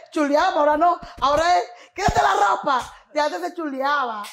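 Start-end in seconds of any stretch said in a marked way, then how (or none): 1.9-2.8 louder and higher mock command; mock blunt demand. Mocking how men flirt today, louder mock command. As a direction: bark it like a crude modern guy.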